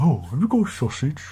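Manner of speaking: a lightly voiced cartoon animal; light silly character voice for a child; little voice. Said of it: "He talked a bit like that".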